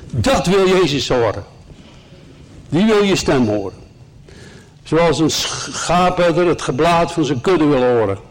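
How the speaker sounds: ordinary delivery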